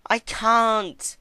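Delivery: high, loud desperate shriek. Acted. Celestia refusing the soup in terror, shrieking. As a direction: shriek it in panic. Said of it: "she shrieked".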